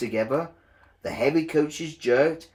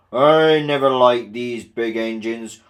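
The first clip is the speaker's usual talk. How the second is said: irritated director; 8 dB louder than usual. A narrator's reading. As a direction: grumble with authority. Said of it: "said the fat director".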